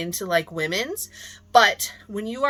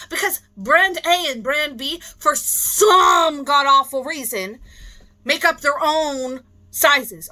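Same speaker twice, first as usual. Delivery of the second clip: exasperated rant; 5 semitones higher, 5 dB louder, slower than usual. Real, not acted.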